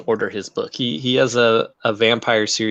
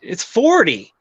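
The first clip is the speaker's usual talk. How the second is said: higher-pitched mock incredulity; retold disbelief; 9 semitones higher, 3 dB louder, slower than usual. Author retells reaction to tiny sales figures, mock incredulous. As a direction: pitch it up, re-enacting your disbelief.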